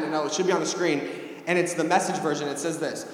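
normal talking voice

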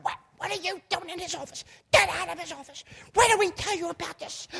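imitated scolding in a very high put-on voice; scolding little sister. Little sister ordering him out of the office, scolding. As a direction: squawk out a high-pitched telling-off.

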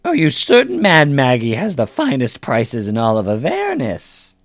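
high crone saleswoman, whispery edge; Mad Maggie. Acted. Hag merchant boasting to customers of her prices, high salesy voice. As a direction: pitch your wares in a high hag voice. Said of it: "My goodness. Customers".

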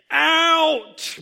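very loud, high, held shout; umpire's bellow. A narrator's reading. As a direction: bellow the call like an ump. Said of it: "bellowed the ump".

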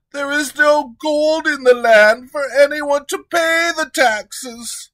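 high, loud anxious pleading; high, loud treasurer pleading with the king. Acted. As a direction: press the point nervously.

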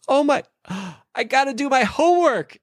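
high, breathless mock panic with a gasp; imitating panicked daughter. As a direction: squeal it like a panicked child who just remembered. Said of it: "she goes".

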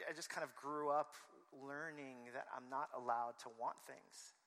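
normal talking voice

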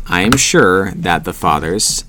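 usual speaking voice

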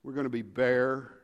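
ordinary delivery

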